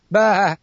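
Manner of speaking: loud bleating baa; sheep bleat. Acted. Bleats again, apologizes.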